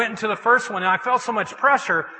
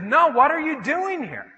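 higher, insistent repeated question; insistent challenge; 7 semitones higher, 3 dB louder than usual. Retold or imitated. God coming back to the man, insistent challenge. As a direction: press the question with a raised pitch.

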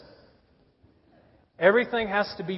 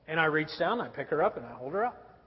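ordinary talk, nothing marked

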